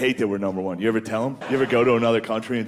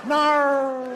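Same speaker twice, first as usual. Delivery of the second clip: put-on Australian accent; 11 semitones higher than usual. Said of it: "an Australian guy in the office".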